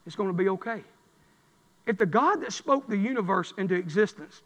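quiet, low, gentle promise that all is well; soft reassurance. Acted. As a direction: bring it way down and comfort them softly. Preacher drops to tender assurance.

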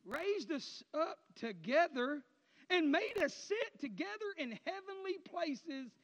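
drawn-out, weighty reading; slow scripture reading. Acted. Preacher reading verse six aloud to the congregation. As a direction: lay each word down slowly.

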